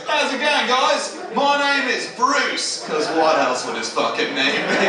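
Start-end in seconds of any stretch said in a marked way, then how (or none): 0.1-2.8 higher-pitched, cheery greeting to camera; upbeat Australian TV storyteller. Retold or imitated. Shark-attack survivor introduces himself to camera, upbeat Australian. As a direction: play Bruce greeting the viewers, pitched up. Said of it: "looks down the camera and goes".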